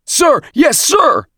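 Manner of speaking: very high, full-voiced recruit yell; loud, strained military shout; shouted in unison. A narrator's reading. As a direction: yell it like a whole drilled platoon. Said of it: "recruits shouted at once".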